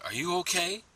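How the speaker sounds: high, upbeat salesman check-in; salesy voice. Retold or imitated. Officer calling to a man reading, salesy voice. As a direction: pitch it up like a friendly salesman. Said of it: "so it's like a salesy voice".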